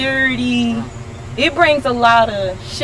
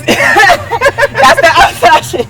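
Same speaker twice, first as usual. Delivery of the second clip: laughing; 11 semitones higher, 9 dB louder, much slower than usual. Real, not acted. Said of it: "Girl, I be watching baddies".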